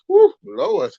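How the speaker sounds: ordinary talk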